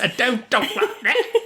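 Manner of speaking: high, laughing mock-Cockney accent; badly faked British accent through laughter; bad accent impression. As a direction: squeal it in a hopeless British accent, cracking up. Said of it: "I can't do the voice".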